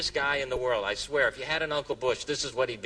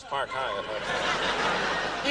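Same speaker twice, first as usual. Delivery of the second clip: low, drawn-out mock dictation; slow note-taking; 7 semitones lower, much slower than usual. Secret Service agents noting the hotel on memo pads, very slow and low. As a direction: stretch each word out as if jotting it down. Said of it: "pull out these memo pads".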